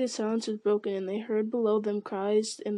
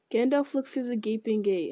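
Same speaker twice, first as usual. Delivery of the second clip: usual speaking voice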